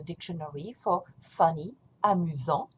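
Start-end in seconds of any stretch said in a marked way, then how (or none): 2.0-2.7 amusant ending on the nasal vowel, no T; silent T. Shown as a demonstration. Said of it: "Don't pronounce the T".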